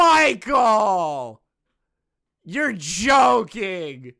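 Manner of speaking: loud exasperated yelling; shouting in disbelief. Real, not acted. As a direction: yell his name in disbelief.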